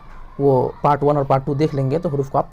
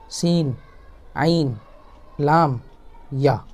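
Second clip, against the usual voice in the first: teacher reading the alphabet aloud, pausing between letters; measured drill recital; slow letter recital; much slower than usual. Shown as a demonstration. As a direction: call out each letter clearly, one at a time.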